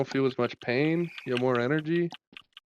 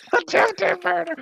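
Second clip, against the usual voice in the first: drawn out through real laughter, much higher; laughing; slower than usual. Real, not acted. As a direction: laugh the words out slowly. Laughing at friend's murder joke.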